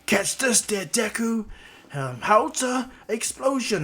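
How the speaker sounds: hammed-up anime attack shout; attack call. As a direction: hurl it as an acted attack call. Bakugo shouts his attack.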